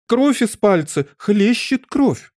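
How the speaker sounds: high, drawn-out panicked cries; shrill screaming. A narrator's reading. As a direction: cry out piercingly, stretching the words. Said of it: "кричала королева". (пронзительный крик, нараспев от ужаса)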